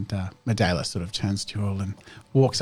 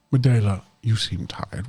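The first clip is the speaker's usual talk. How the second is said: ordinary delivery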